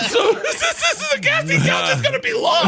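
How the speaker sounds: high, loud laughing squeal; laughing hard. Real, not acted. Comic telling his hosts the punchline, laughing hard and high.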